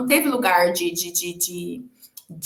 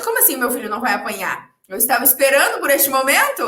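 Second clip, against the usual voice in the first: ordinary talk, nothing marked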